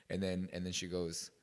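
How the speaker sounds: ordinary talk, nothing marked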